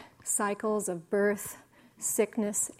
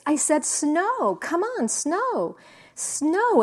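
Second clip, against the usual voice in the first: demanding Calvin; 7 semitones higher, 5 dB louder, slower than usual. Retold or imitated. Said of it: "He says".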